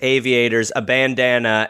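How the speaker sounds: ordinary talk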